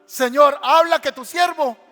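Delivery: coached answer. Acted. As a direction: announce it boldly to God. Eli teaching the reply. (respuesta proclamada en voz alta)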